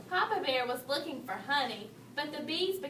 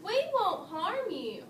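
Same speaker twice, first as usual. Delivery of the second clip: higher, slow call; 6 semitones higher, slower than usual. A narrator's reading. Mama and Papa Bear calling after Goldilocks, higher slow call. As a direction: reassure her from afar. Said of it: "called Mama and Papa Bear".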